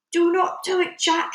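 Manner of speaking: high, loud plea; giant's screech. A narrator's reading. Giant begging Jack to stop, screeching. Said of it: "he screeched".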